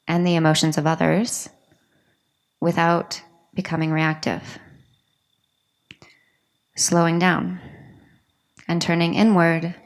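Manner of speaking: guided meditation. Acted. Teacher leading a room into yoga nidra, guided meditation. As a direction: guide them inward phrase by phrase, with long pauses.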